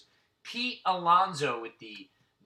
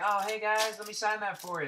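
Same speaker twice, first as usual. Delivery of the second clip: imagined friendly star; 8 semitones higher than usual. Retold or imitated. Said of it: "We figured he'd be like".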